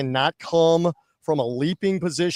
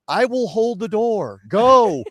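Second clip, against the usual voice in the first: higher, slow, drawn-out command; dramatic send-off; 4 semitones higher, 4 dB louder, slower than usual. Acted. Media man showing complaining fans the door, dramatic send-off. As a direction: order the haters out with ceremony. Continues his famous send-off.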